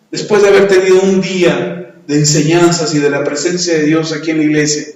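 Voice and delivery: ordinary talk, nothing marked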